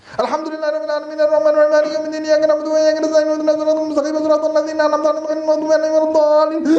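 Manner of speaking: extremely fast, breathless rattle of the whole Fatiha; one-breath Fatiha. Shown as a demonstration. Preacher mocks people who rush the Fatiha in one breath. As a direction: blast through it in a single breath. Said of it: "One breath Fatiha".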